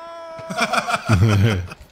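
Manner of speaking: high-pitched real laugh; high giggle. Real, not acted. Amused by the announcer.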